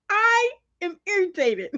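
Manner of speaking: high, put-on irritated voice; mock irritated. Laughing while telling boyfriend she's irritated, mock annoyed. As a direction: play up being annoyed. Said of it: "I'm like".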